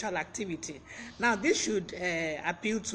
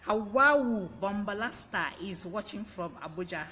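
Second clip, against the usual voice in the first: ordinary talk, nothing marked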